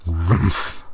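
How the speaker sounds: short animal snort-growl; bear snort. A narrator's reading. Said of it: "snorted the bear".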